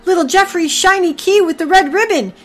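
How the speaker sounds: loud, high, thrilled discovery; excited shout. Acted. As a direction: shout it out, overjoyed. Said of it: "he shouted".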